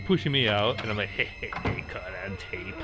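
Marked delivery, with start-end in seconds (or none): 1.1-2.8 whispered, soft gloating tease; teasing whisper. Retold or imitated. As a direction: whisper it like a sneaky prankster. Teasing her while filming.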